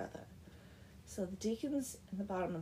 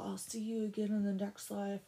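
ordinary talk, nothing marked

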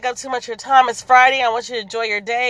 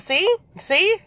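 pointed see see; 9 semitones higher than usual. Real, not acted. Mom making her point.

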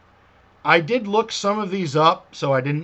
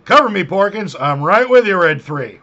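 cover me shout; 2 semitones higher, 8 dB louder than usual. Retold or imitated. Quoting X-Wing pilot line.

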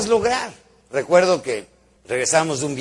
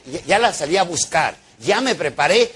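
ordinary talk, nothing marked

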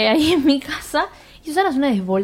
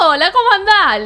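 higher, louder pally hello; cheery greeting; 8 semitones higher, 6 dB louder than usual. Retold or imitated. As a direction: greet her like an old friend. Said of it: "le dice".

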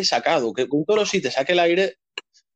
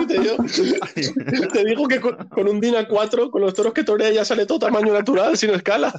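laughing; 5 semitones higher than usual. Real, not acted. Joke told while laughing.

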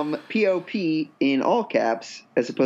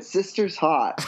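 put-on slow Boston accent with a laugh; slower than usual. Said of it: "That's really good".

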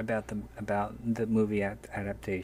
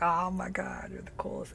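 breathy whispered gush of awe; hushed fanboy thought, barely voiced; inner whisper; 10 semitones higher than usual. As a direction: whisper it like a starstruck fan talking to himself. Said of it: "In my head".

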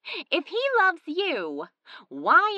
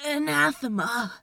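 croaked. Acted. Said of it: "Crowley croaked".

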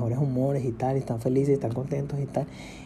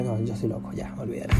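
voz interior apagada; 3 semitones lower, 7 dB quieter than usual. Retold or imitated. Se responde a sí mismo.

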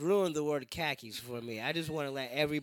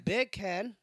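"beer can" higher, British, r dropped from "beer"; British accent; 5 semitones higher, 4 dB louder than usual. Shown as a demonstration. Comic finally lands the joke word, British accent. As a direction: attempt a British "beer can" that sounds like bacon. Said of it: "I can't do a British accent".

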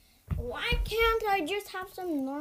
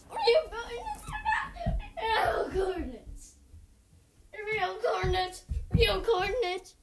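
shrieking, laughing manic rant; wild unhinged ranting; 5 semitones higher, 4 dB louder than usual. Real, not acted. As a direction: lose it completely, half laughing. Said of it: "I know I'm acting like a crazy person".

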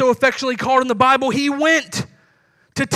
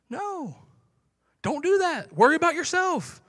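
higher, dismissive advice; mock scolding; 4 semitones higher, 5 dB quieter than usual. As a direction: pastor imitating a skeptic scolding the giver, higher dismissive voice. Outsider scolds generosity.

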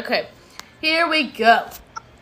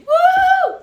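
excited cheer; 9 dB louder than usual. Real, not acted. Cheering for a wrestler.

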